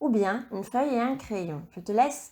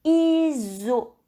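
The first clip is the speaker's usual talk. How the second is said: dictation voice; 5 semitones higher, 4 dB louder than usual. Shown as a demonstration. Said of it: "Et pour terminer".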